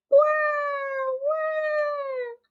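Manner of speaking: put-on very high baby crying; baby wail. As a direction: wail like a newborn. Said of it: "Polly se echó a llorar". (llanto de bebé muy agudo)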